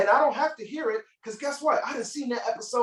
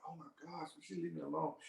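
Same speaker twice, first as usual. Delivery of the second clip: much softer, lower exasperated aside; muttering to self; 5 semitones lower, 15 dB quieter, faster than usual. Retold or imitated. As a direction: grumble it under your breath. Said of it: "I'm like".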